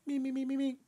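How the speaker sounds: high-pitched repeated warm-up notes; sing-song actor's warm-up on one high note; mock vocal warm-up. Performer warming up before an accent demo, mock serious.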